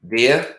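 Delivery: "there" as "they-ah", r dropped; Boston accent on "there", high and rising. Shown as a demonstration. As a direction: drop the r of "there" Boston-style. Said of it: "Boston accent".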